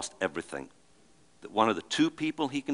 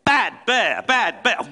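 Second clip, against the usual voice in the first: higher, louder hammed-up scolding of a bear; mock reprimand; 10 semitones higher, 6 dB louder, slower than usual. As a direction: scold the grizzly like a naughty pet, tongue in cheek. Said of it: "how are you gonna reprimand a grizzly bear?".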